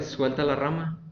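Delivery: ordinary talk, nothing marked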